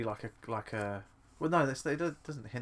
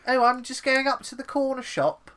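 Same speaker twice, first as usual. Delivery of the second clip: high, loud, silly English voice; hammed-up English accent, pitched way up; mocking English accent; 13 semitones higher, 10 dB louder than usual. Mocking English friend's stupid voice, exaggerated English accent. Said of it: "and your stupid voice".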